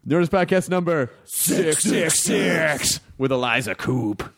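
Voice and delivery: slow, booming devil announcer. Acted. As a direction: announce it like a smooth demon. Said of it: "[name]'s got a nice devil voice".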